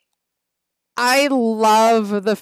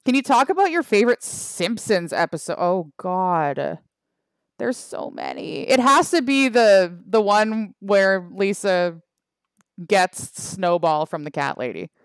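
ordinary talk, nothing marked

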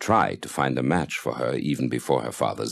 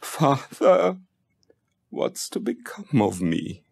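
wailing in despair; 9 semitones higher, slower than usual. A narrator's reading. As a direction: cry out to her dying father, then sink into helplessness. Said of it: "began to wail in despair".

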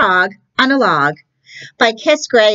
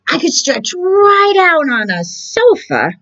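much higher, crying-tinged frog whine; whiny frog; 12 semitones higher than usual. Acted. Frog begs the cat for a sofa, high whiny voice. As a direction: whimper the wish like a pleading child.

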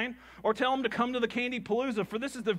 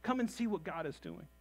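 soft urging invitation; 9 dB quieter than usual. Retold or imitated. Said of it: "that just goes".

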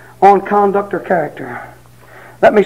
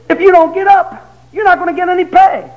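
higher mock wife scolding, a bit louder; wife warning husband about pay, higher mock scold; 10 semitones higher, 4 dB louder than usual. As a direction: warn him like a fed-up wife.

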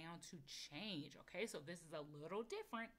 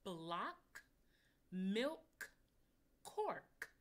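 words called out, rising, each set apart; clear model words; 6 dB louder, much slower than usual. Shown as a demonstration. Teacher sounding out words for children. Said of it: "ready for this one".

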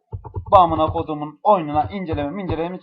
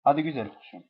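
normal talking voice

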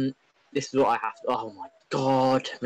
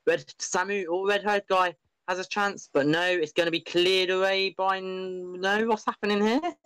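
excited; 7 semitones higher than usual. Real, not acted. Frantic goalmouth scramble commentary.

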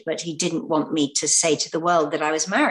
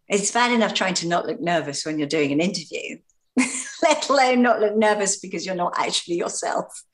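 ordinary talk, nothing marked

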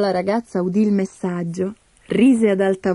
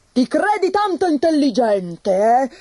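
higher, sharp mocking outburst; snapping tsar; 5 semitones higher than usual. Grumpy old tsar to the tsarina, snapping. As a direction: lash out at the clever wife. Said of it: "sbottò un giorno".

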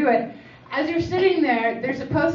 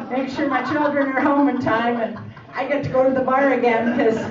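ordinary talk, nothing marked

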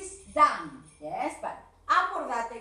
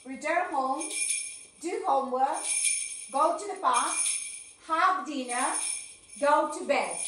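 louder teacher drill voice; repetition drill; 3 dB louder, slower than usual. Shown as a demonstration. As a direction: lead the class through the list. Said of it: "vas a pronunciar más los verbos". (la maestra dirige la repetición en voz alta)

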